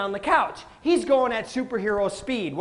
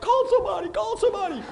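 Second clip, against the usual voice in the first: alarmed bystanders; 10 semitones higher, faster than usual. Retold or imitated. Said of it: "people are like".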